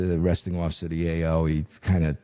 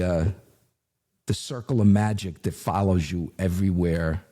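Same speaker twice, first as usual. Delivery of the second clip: usual speaking voice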